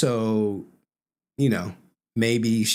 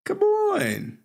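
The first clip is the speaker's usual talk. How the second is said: much higher, squeaky teasing come on; mocking come on; much slower than usual. Real, not acted. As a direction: pitch it way up and taunt. Host mocking the guest in the clip.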